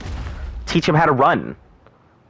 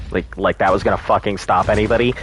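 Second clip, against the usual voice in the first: ordinary talk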